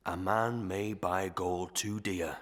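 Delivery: ordinary delivery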